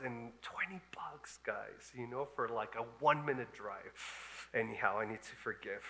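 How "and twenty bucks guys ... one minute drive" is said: whispered aside. Real, not acted.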